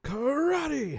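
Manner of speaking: high, stretched-out martial arts yell; playful drawn-out fight shout; mock battle cry. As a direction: call it out high, holding the first syllable.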